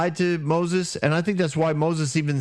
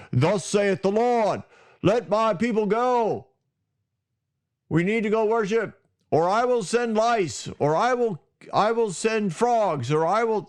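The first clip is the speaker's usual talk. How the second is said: stern prophet voice; 7 semitones higher than usual. Retold or imitated. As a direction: thunder it like a wrathful prophet. Mock stern Moses ultimatum.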